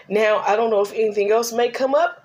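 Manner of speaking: usual speaking voice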